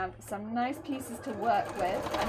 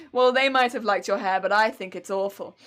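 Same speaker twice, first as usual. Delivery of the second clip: mock rude customer; 3 semitones lower, 8 dB louder than usual. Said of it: "the next customer would be like".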